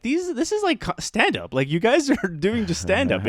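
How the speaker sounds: high-pitched amused disbelief, laugh breaking in; amazed disbelief. Retold or imitated. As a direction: marvel at it with a laugh. Retells amazed reaction to funny poems.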